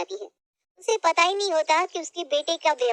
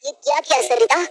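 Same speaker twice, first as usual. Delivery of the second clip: louder, rising complaint; irritated husband; 3 semitones higher, 5 dB louder than usual. Acted. Husband complains to his wife before his friends, louder. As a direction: snap at the meagre dinner. Husband angry at plain pulao.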